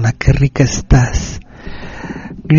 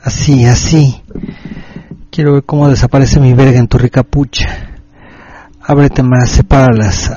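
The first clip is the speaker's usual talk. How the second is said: seductive dirty talk; 3 semitones lower than usual. Acted. Late-night radio host to Ruth, sultry and low. Erotic message to listener.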